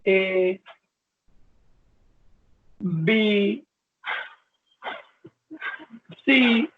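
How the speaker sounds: very slow, long gaps between letters; slowed patient speech. Shown as a demonstration. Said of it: "यदि Parkinson disease होगी".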